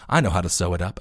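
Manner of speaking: low, quiet robot doctor; a deep quiet robot voice. Retold or imitated. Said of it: "the robot, like".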